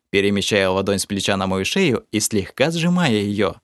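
ordinary delivery, nothing marked

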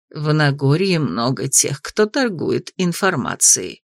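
put-on low, slow hushed old grandmother; old woman whispering. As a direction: murmur it low like a secretive granny. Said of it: "прошептала в ответ бабушка".

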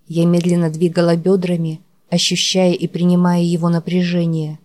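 plain reading voice

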